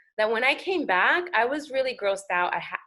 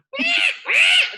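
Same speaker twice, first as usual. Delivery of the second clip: high, loud shrieking animal cry; Tasmanian devil screech; 9 dB louder than usual. Retold or imitated. Guest imitating Tasmanian devil for host, high loud screech. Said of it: "That's what they sound like".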